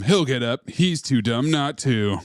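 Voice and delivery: ordinary talk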